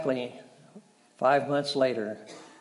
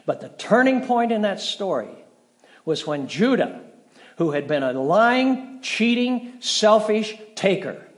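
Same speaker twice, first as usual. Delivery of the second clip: rising emphasis; 7 semitones higher, 6 dB louder than usual. Acted. Preacher denouncing Judah's past to the congregation, loud and emphatic. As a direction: build the indictment word by word. Sermon climax.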